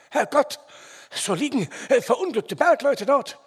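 high, loud and shocked; alarmed exclamation. A narrator's reading. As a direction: blurt it out in alarm. Shocked guess about trapped miners.

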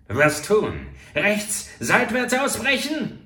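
loud and high, rushed alarm; trapped rider asking his partner what to do; frantic question. A narrator's reading. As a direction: blurt the options in panic. Trapped murderer asks frantically.

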